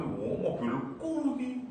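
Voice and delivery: high, drawn-out show-off voice; hammed-up pride, pitched well up; exaggerated boasting. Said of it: "exaggerating sebenarnya".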